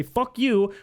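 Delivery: high mocking fuck you; mock sneering insult. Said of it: "they're gonna say".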